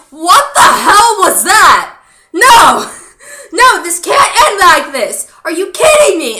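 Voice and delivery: high, loud, laughing protest, slowed at the start; laughing, outraged cry of no; retold outburst. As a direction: relive yelling at the screen. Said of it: "I was like".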